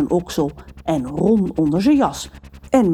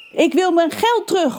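calling out; 11 semitones higher than usual. A narrator's reading. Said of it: "roepen ze".

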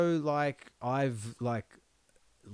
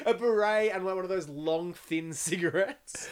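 laughing; 8 semitones higher, 13 dB louder than usual. Real, not acted. Laughing while describing raptor.

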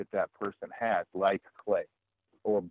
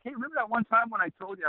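high, quick, smug needling; gloating buddy; 13 semitones higher, faster than usual. Retold or imitated. Buddy reminds him he was right, high smug needling. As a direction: rub it in like a know-it-all friend.

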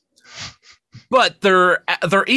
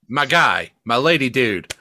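ordinary talk, nothing marked

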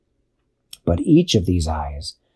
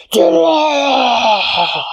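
spiders wailing; much slower than usual. A narrator's reading. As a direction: howl it out like tortured spiders. Spiders cry at the noise.

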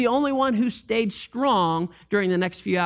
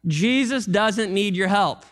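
ordinary delivery

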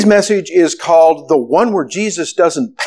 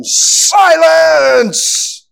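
sudden high commanding shout; Dumbledore yelling; 11 semitones higher than usual. Retold or imitated. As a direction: bark it out to silence a panicked room. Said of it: "yells at them".

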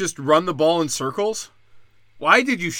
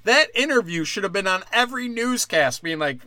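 ordinary talk

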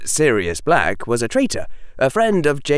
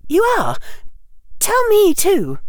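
put-on high, louder, prim girl's voice; primly insist, pitched right up; 5 dB louder than usual. A narrator's reading. Hermione demanding Harry share the joke, primly. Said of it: "Hermione said primly".

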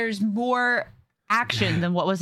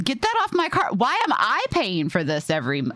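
higher, swooping mock outrage; indignant retort; 4 semitones higher than usual. Griping about paying for license plate ads, mock-indignant. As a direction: tell them off, exasperated.

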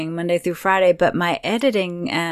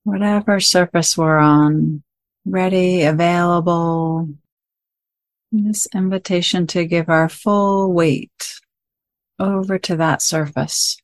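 guided meditation; 7 dB louder than usual. Acted. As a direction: guide listeners gently into stillness, phrase by phrase.

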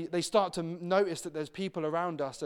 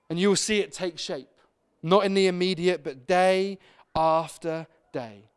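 much louder, slow, emphatic sermon delivery; preaching at full force; 10 dB louder than usual. Acted. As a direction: drive the closing point home to the congregation. Sermon climax builds to close.

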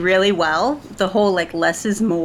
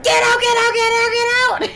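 high, loud, rapid-fire shriek; retold frantic yell; 4 dB louder than usual. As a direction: reenact panicked shouting at intruders. Retelling her outburst during birth.

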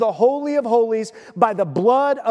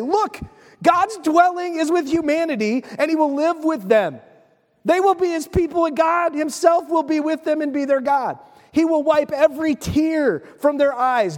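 raised, higher voice reading the throne's call; loud voice from the throne; 6 semitones higher than usual. A narrator's reading. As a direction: proclaim it like a voice from heaven. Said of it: "I heard a loud voice from the throne say".

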